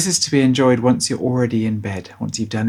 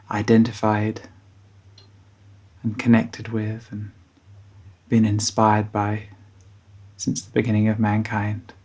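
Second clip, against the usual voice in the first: guided bedtime meditation. Acted. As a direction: guide children gently towards sleep, unhurried.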